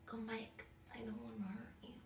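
soft whispered coaxing; whispering. Real, not acted. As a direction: whisper gently to a bug. Coaxing bug to come back.

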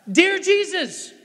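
put-on high, loud, drawn-out child's prayer; singled-out words, way up high like a child; little kid praying. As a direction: imitate an eager little kid starting to pray. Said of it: "you watch little kids".

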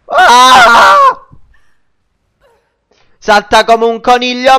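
frantic high-pitched shouting; explosive italian cursing. Real, not acted. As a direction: yell it like you're running for your life. Fleeing danger in game.

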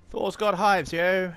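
hyped up. Acted. Hyped title shout.

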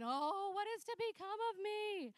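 hammed-up little child crying out in anguish; mock despairing child. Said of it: "holding onto the playpen rails going".

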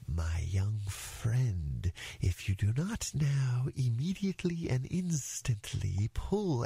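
slow, ponderous rock snake voice; pompous python. Acted. Python advises the elephant's child, slow and pompous. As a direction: draw out each phrase with great formality. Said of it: "the way bicolored python rock snakes always talk".

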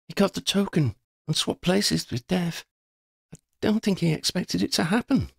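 put-on a girl's voice, matter-of-fact; young woman Shona. A narrator's reading.

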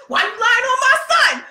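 high, loud mock outrage in her head; inner angry mom. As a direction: yell it only in your head. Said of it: "I didn't do that. That's what I was thinking".

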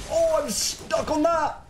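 high-pitched cry of dismay; dismayed outcry. Real, not acted. Player stuck in the game, dismayed outcry. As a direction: cry out as you get trapped.

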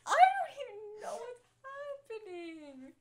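loud, high fake sobbing; fake crying. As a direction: sob it out loud, overdone. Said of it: "How to fake cry".

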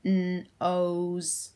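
NOSE sounded out in a monotone, n apart from ose; robot splitting NOSE into two sounds. Shown as a demonstration. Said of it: "in my robot voice".

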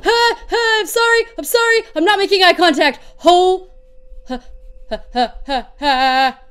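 ordinary talk

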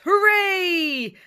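high, loud cheer; captain's shout. Acted. Pirate captain shouting with joy on finding the bay. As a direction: yell it in triumph. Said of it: "The captain shouts".

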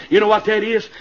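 ordinary talk

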